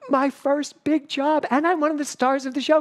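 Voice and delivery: put-on high-pitched, gushing young enthusiasm; starry-eyed excitement. Young actor on a first big job, gushing excitedly. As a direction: imitate your thrilled younger self, voice way up. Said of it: "twenty-year-old who was like".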